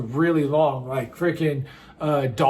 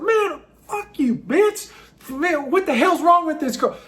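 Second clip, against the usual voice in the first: much higher, indignant mock tough guy; angry guy; 9 semitones higher than usual. Choked guy cursing at the drunk girl, mock indignant. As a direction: sneer it as an offended bar dude. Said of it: "It's like".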